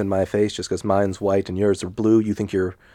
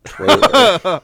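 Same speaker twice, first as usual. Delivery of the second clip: laughing announcement; 10 semitones higher, 6 dB louder than usual. Acted. Mock trailer announcement.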